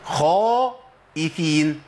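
خائفين with a full emphatic خ and a dark o, slow; خائفين with the خ heavy, lips forward; heavy emphatic kha. Shown as a demonstration. Teacher giving the correct reading of the word. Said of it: "ده الصواب".

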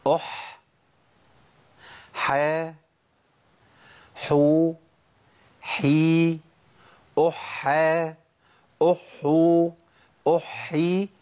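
أح حا حو حي with a crisp breathy ح each time; ha drill. Shown as a demonstration. Teacher drilling all the forms of the letter. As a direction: chant the ح with each vowel in turn. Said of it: "حالات حرف الحاء".

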